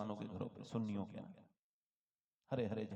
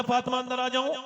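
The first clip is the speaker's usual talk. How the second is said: calling out; 9 semitones higher, 13 dB louder than usual. A narrator's reading. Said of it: "आवाज दी हुजूर ने".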